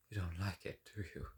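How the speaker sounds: usual speaking voice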